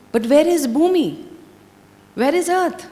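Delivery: shocked question. Acted. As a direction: ask it in sudden alarm. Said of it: "turns back in a shock".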